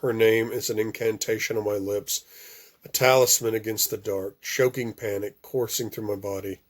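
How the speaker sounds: usual speaking voice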